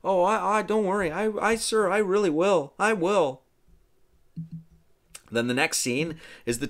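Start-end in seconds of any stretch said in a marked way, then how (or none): 0.0-3.4 put-on high, wavering boy's promise; hurried reassurance pitched up high; eager young squire. Arthur answering a scolding about his schooling, eager and high.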